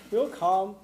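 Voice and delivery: normal talking voice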